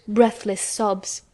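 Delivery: ordinary delivery, nothing marked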